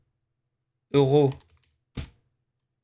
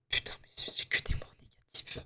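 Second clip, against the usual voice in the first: frustrated whisper; 14 dB quieter than usual. Real, not acted. Frustrated at his game guesses.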